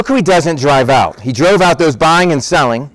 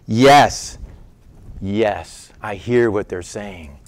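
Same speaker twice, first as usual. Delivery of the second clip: much softer, low and slow; quiet calm reply; 7 semitones lower, 6 dB quieter, much slower than usual. Acted. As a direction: let it drop to a quiet, steady answer. Jesus answers the indignant priests.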